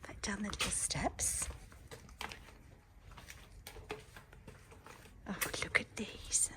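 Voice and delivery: soft hushed whisper all the way through. Real, not acted. Showing viewers the back courtyard of the new house, hushed whisper. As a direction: keep it down so the neighbours don't hear. Touring courtyard, neighbours nearby.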